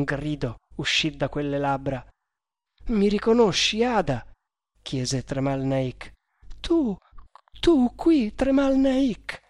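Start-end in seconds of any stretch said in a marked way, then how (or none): 2.9-4.3 higher-pitched eager appeal; urgent question. A narrator's reading. Indian to the girl just revived, urgent question. 6.6-9.4 put-on much higher, astonished girl's voice; high, drawn-out disbelief, not truly faint; faint-voiced exclamation. A narrator's reading. Girl waking to find Tremal Naik, faint-voiced exclamation. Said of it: "esclamò lei con voce fioca".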